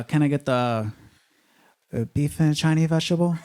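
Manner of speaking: hesitant, lightly put-on Chinese accent; mock Chinese accent. Awkward customer ordering takeout, mock Chinese accent. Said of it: "slightly racist when you're ordering Chinese food".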